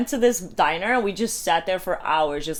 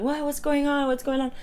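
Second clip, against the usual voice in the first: high, startled mock confusion; confused friend; 7 semitones higher than usual. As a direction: imitate a bewildered, just-woken friend. Woken friend's confused reaction retold.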